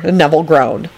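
ordinary delivery, nothing marked

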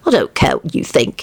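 low, stubborn statement; stubborn announcement. A narrator's reading. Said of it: "he announced stubbornly".

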